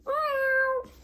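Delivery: high, drawn-out kitty meows; cat meows. Pretend. Playing a cat for the viewers, high meows. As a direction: meow like a little cat. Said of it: "Kitty cats".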